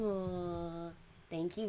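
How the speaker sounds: ordinary talk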